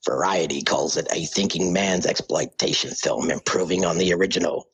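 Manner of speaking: deep, low-pitched American trailer announcer; American movie trailer voice. Acted. Australian caller reads film reviews to podcast hosts, deep American trailer voice. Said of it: "American Deep Trailer Voice".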